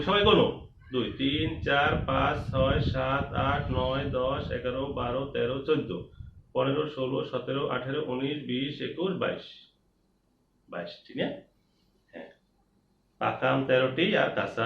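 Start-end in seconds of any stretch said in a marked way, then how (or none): 0.9-9.7 slower, measured counting chant; counting along together. Shown as a demonstration. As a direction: count so the whole class can follow. Recount for everyone to follow.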